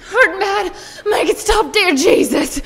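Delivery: high, loud pained wail; moaning in pain. Acted. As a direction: cry out through the pain. Labouring mother moans in pain.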